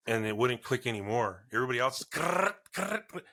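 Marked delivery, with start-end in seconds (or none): 2.0-3.2 high clicking grrrt, imitating a seat restraint; ratchet clicking noise.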